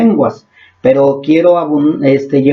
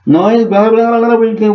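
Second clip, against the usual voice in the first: mock babble; 6 semitones higher than usual. Shown as a demonstration. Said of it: "saca la bazuca".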